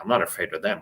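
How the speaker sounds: ordinary delivery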